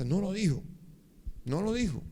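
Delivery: high-pitched mock complaint; hurt, repeated grumble; aggrieved lament. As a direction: replay your own disbelief, pitched high. Retold grievance on arriving home.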